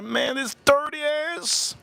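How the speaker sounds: high, drawn-out exasperated aside; acted exasperation. Driver fed up with being insulted, high drawn-out exasperation. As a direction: drag it out in mock disbelief.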